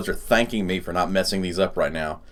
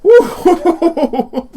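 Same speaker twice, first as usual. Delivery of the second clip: excited whoop; 10 dB louder than usual. Real, not acted. Cheers on seeing the result.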